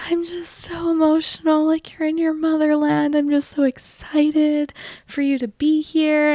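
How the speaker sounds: high, soft, slow gushing mom voice; imitating teary mom. Mom gushing to daughter at dinner, high and soft teary voice. She starts crying.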